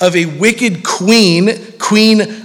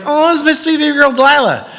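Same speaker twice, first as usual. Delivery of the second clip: high, quick mock-doting parent; imitated proud parent, pitched up; gushing parent; 9 semitones higher, faster than usual. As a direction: coo over a newborn girl, hammed up. Voices parents gushing over baby.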